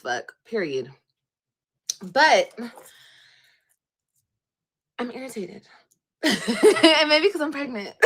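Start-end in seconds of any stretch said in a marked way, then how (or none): 6.2-8.1 slow words breaking into a high burst of laughter; laughing. Real, not acted. Admitting to viewers she's pregnant, laughing loudly. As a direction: laugh it out, louder, as the excuse lands. Laughs at her own excuse.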